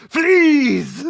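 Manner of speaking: hammed-up wailing plea; screamed plea. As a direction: scream it desperately. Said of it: "and just".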